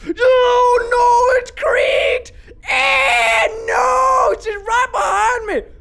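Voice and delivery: high, loud frightened yells; terrified screaming. Real, not acted. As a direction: scream like she's on your heels. Door creaks, Granny behind him.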